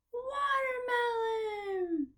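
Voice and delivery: high, drawn-out cheer on watermelon; triumphant singsong blend; excited reveal. Shown as a demonstration. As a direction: swoop watermelon out high and slow, gliding down at the end. Teacher joins syllables into whole word.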